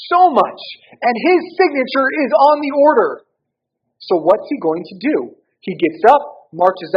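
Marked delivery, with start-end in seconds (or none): 0.1-3.3 voiced king's fury.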